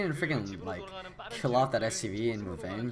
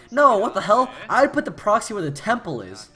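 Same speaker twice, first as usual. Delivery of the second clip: incredulous; 7 semitones higher, 7 dB louder than usual. Retold or imitated. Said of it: "I'm like".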